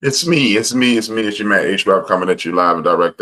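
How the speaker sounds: loud, high announcer shout easing down in pitch; hyped show-opener. Acted. Host greeting the audience live and direct, hyped show-opener. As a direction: belt out the host's intro.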